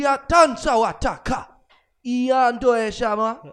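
loud, high, made-up African chanting; hammed-up African-language parody, high and forceful; mock African native speech. Shown as a demonstration. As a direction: declaim gibberish in a fake African accent. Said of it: "African native speaking".

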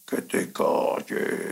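slow, low, voiceless rasp, demonic; terrifying voice. Retold or imitated. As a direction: drag it out in a hoarse, frightening whisper. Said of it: "con una voz de terror". (voz de terror, lenta, ronca y susurrada)

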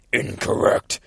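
rasped, voiceless demon verdict. Retold or imitated. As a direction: rasp it out like a demon. Demon answers in retold comic.

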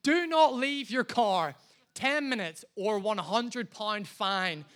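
slow, emphatic sign reading; massive-letter warning. Retold or imitated. Car park sign warning drivers of a fine, slow emphatic warning. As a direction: read it out slowly, hitting each word hard. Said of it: "massive letters that said".

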